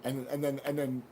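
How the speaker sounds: ordinary talk, nothing marked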